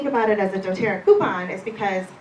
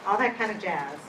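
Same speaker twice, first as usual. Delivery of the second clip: normal talking voice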